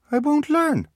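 put-on high wary little-boy voice; a small child checking the deal; suspicious toddler. Beckett checks suspiciously, high toddler voice. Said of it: "Beckett was suspicious".

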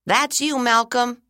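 put-on high, loud kid calling out; called out teasingly. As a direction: call it across the classroom. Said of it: "someone called out".

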